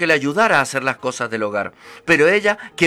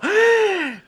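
surprised gasp. Acted. Mother astonished at the gold.

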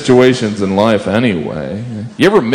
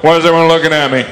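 lightly voiced mounted cop, higher and quick; quick, higher mock wondering; self-conscious policeman; 7 semitones higher, faster than usual. Policeman on a horse being stared at, mock-puzzled higher voice. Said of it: "They always have that look like".

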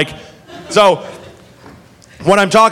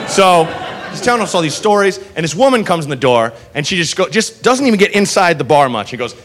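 ordinary delivery

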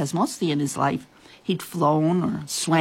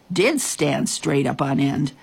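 ordinary delivery

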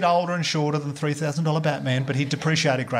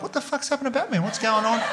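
shocked neighbours; 7 semitones higher than usual. Retold or imitated. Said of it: "Neighbours coming out, like".

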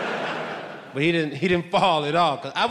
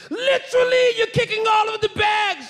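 very high, strained mock-British yell; hammed-up angry British guard, pitched way up. Security guard scolding him over the bags, bad British accent yelling. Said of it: "He's yelling at me in British".

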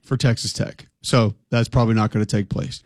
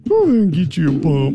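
higher, hammed-up coach voice; O-line coach impression; 4 semitones higher than usual. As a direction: coach teasing a lineman about his helmet. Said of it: "impersonate offensive line coaches".

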